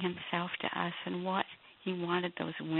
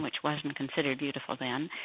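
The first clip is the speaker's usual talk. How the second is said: ordinary talk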